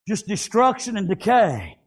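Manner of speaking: ordinary talk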